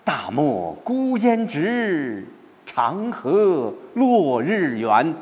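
spacious, uplifted reading of 大漠孤烟直 长河落日圆; opening up, expansive. Shown as a demonstration. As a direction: let the feeling open wide across the desert. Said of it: "把感情转过来".

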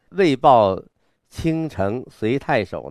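“为报”重读后停一下，再慢读“倾城随太守”; 大停顿. Shown as a demonstration. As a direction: put a clear pause after 为报, then slow down. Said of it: "和前面应该有一个大的停顿". (“为报”响亮，停顿，“倾城随太守”放慢)

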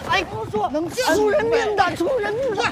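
panicked warning. Acted. Pleading with brawlers before someone gets killed, panicked warning. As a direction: plead in alarm, voice pitched high.

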